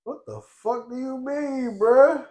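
high, loud disbelieving bruh; incredulous retort. Retold or imitated. Telling the coworker about his reaction, incredulous high retort. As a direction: throw it back at him in disbelief.

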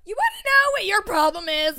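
high, loud mock tirade; mock rant. To Big Jeans for canceling, a hammed-up angry rant. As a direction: wind up to tear into someone. Retold flip-out at a friend.

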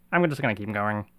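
normal talking voice

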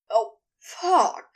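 sudden moaning outburst; moaned. A narrator's reading. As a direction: moan it out, overcome. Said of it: "Crowley moaned".

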